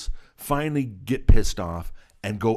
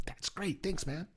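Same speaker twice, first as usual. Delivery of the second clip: high, much softer director impression; Quentin Tarantino; 9 semitones higher, 13 dB quieter than usual. Director thanks kid for helping, soft high voice. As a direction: thank him in a small high voice. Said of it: "And he goes".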